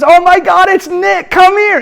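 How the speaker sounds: thrilled welcome. Retold or imitated. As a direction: gush like she's greeting an old friend. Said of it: "And she goes".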